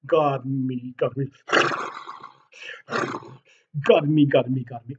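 loud moaning garmy; complaining horse. Acted. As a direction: groan like a hot horse.

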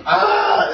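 high-pitched acted monster shriek. Game master voices the oni struck by arrows, shrieking. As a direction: cry out as the creature is hit. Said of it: "It lets out a shriek".

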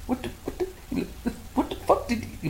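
high, breathless whispered disbelief; hushed shocked what the; stunned whisper. Retold or imitated. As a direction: gasp it out under your breath. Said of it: "I'm like".

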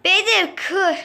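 sudden shout. Real, not acted. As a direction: blurt it out in a burst of alarm. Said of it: "anlık gelen bir şeyle bağırdım".

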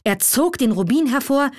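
plain reading voice

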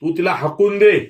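high, loud crowd yell; shouted demand. A narrator's reading. Patil's lane yelling at Dhanaji to drive her out, shouted demand. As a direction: shout it as an angry order. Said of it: "आणि पाटील आळी ओरडली".

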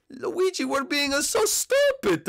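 high whine, stretching the last words; exasperated lament. Acted. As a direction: wail it out with mock exasperation. Mario laments their squabble.

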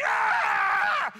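put-on long, loud yell of a thrown kid; hammed-up drawn-out scream; imitated scream. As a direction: scream it out as if flying into the pool.

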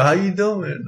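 Wendy Williams catchphrase. Retold or imitated. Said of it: "Can you do a good Wendy Williams".

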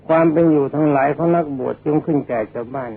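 ordinary talk, nothing marked

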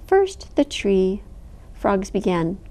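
plain reading voice

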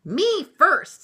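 high, loud, self-important claim; puffed with pride. A narrator's reading. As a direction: push in loudly, full of yourself. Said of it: "all puffed with pride".

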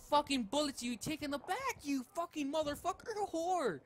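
high-pitched exasperated rant; angry outburst. Real, not acted. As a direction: rail at an enemy who won't die. Rage at enemy player in game.

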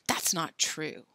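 slow hushed whisper. A narrator's reading. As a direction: say it under breath. Quiet retort.

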